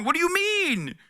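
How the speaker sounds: very high incredulous retort; can't believe what they're saying. Real, not acted. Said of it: "incredulous retort".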